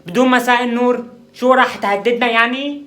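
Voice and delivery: high, loud mocking outrage; indignant shout. Shouting after the roommate who left, high and indignant. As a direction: yell back at him, scandalised.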